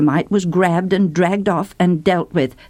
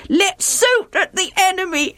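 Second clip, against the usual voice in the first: high, giggling quote; laughing mock; 11 semitones higher than usual. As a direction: laugh through the silly line. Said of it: "sound so funny to me".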